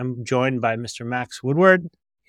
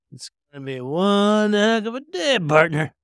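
higher, louder folksy cowboy; 8 semitones higher, 5 dB louder than usual. Acted. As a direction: drawl it like a ranch hand. Cowboy-style ad sign-off.

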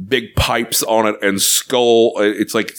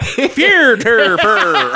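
high puppet gibberish, breaking into laughter; sing-song nonsense in a comic high voice; Swedish Chef; 13 semitones higher, 4 dB louder, much slower than usual. Acted. Puppet chef repeats the challenge, comic gibberish voice. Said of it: "Swedish Chef goes".

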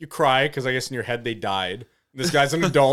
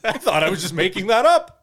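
higher, incredulous protest; acted astonishment; 6 semitones higher, 4 dB louder than usual. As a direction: play the baffled culprit. Suspect's astonished reply, acted out.

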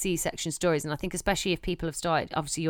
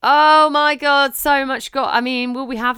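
excited; 7 semitones higher, 9 dB louder than usual. Real, not acted. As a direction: stretch it out in delight. Excited about gossip.

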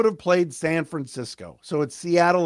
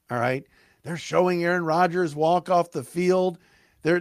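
ordinary delivery

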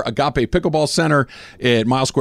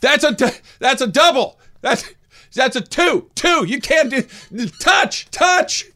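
very high, insistent mock yells; imitated yelling; 12 semitones higher, slower than usual. Mother yelling at volleyball referees, imitated. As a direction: mimic repeated shouts at the referee. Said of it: "Susan yells at referees in volleyball".

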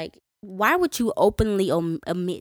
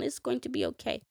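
softer, gentle reassurance; father comforting his little daughter, much softer; consoling dad; 2 semitones higher, 10 dB quieter than usual. Retold or imitated. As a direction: soothe a crying child. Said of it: "nice and sweet and hugging and consoling".

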